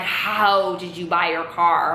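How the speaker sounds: slow question with "How" long drawn out; riddling mom. Retold or imitated. Mom pressing her daughter about the car, slow and riddling. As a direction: stretch "How" out pointedly, imitating an interrogation. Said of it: "talking almost in, like, a riddle".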